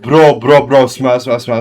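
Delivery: loud call for attention; loud, urgent call to a friend. Real, not acted. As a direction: shout his name to get him to listen. Calling friend to listen.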